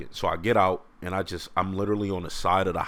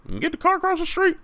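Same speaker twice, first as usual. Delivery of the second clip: very high, fast mock cop voice; hammed-up high officer voice; 4 dB louder, faster than usual. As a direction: rattle off the order in a squeaky voice. Police officer ordering.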